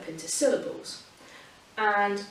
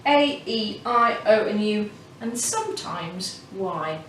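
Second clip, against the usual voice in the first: slow, deliberate vowel list; slower than usual. Shown as a demonstration. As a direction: spell out the vowels like a teacher. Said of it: "we say A".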